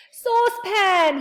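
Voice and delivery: high, loud, drawn-out call; yelled. A narrator's reading. Friend looking up in amazement, yelling high and long. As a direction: holler the name in delighted surprise. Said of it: "he yelled".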